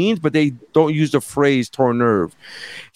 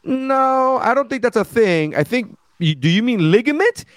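incredulous correction; 7 semitones higher than usual. Retold or imitated. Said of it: "I was like".

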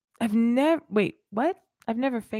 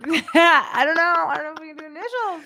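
laughing; 8 semitones higher, 7 dB louder than usual. Real, not acted. Coy laughing reply.

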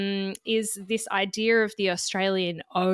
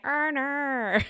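exaggerated, drawn-out Aussie "oh, naur"; higher, hammed-up Australian "oh, no"; internet's Australian accent; 6 semitones higher than usual. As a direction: mimic the online parody of Australian O. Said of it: "as everyone on the internet keeps saying".

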